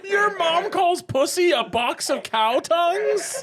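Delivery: high, slow, laughing squeal of disbelief. Real, not acted. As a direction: shriek it through laughter.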